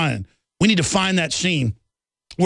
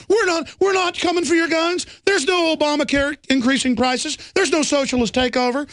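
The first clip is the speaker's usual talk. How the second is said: higher, sarcastic fake-reassuring voice; mocking officials; 7 semitones higher than usual. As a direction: parody the smooth official denial, higher.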